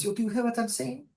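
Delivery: high-pitched motherly voice, gently insistent; old mother. Acted. Old mother questioning crying daughter, high gentle voice. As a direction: tenderly check she is listening, high voice. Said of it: "and the woman's like".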